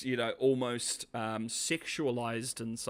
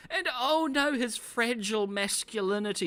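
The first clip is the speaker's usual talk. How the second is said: mock alarm; 9 semitones higher than usual. Real, not acted.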